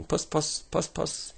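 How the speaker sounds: very high, coaxing call; calling a cat. A narrator's reading. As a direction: call puss in a little high voice.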